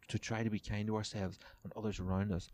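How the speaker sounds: normal talking voice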